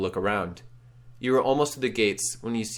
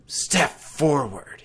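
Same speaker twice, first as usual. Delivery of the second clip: very slow, drawn-out ghostly order; command; 5 semitones higher, much slower than usual. Acted. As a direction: beckon them in a spectral drawl. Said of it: "he commands".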